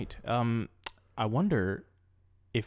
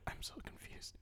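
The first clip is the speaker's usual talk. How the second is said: whispered aside; whispering in court, baffled; confused whisper; 23 dB quieter than usual. Acted. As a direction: whisper it to nobody.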